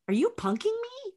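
high, quick squeal of disbelief; incredulous. Retold or imitated. Puppeteer asking if the call is a prank, incredulous.

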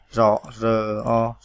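usual speaking voice

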